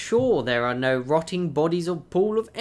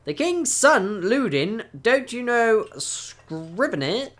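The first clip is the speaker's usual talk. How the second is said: high, louder, slow pointed retort; sharp reproach to the rude warrior; pointed correction; 7 semitones higher, 3 dB louder than usual. Acted. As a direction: correct him with an edge. NPC correcting another.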